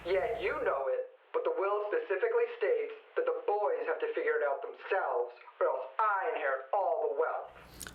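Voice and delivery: voice on the phone. Acted. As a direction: sound small, down a telephone line. Phone call, heard from other end.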